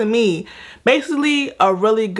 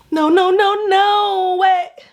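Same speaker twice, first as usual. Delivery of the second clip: shocked no no no; 11 semitones higher, slower than usual. Retold or imitated. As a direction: act out a jolt of shock and denial. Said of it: "I'm sitting here like".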